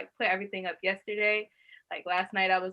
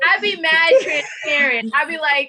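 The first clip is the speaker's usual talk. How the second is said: animated; 6 semitones higher, 9 dB louder than usual. Real, not acted. Laughing banter, loud high.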